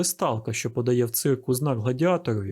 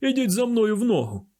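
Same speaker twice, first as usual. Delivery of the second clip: higher-pitched officer's command; acted military command, pitched up; exclaimed order; 9 semitones higher than usual. A narrator's reading. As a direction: call out an order to follow. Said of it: "вигукнув унтер-офіцер".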